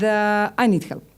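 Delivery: ordinary delivery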